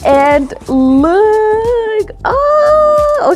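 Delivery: high squeal held long; so excited. Real, not acted. Vlogger revealing the box's contents to viewers, so excited. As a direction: climb into a delighted, stretched squeal. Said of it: "okay, I'm so excited".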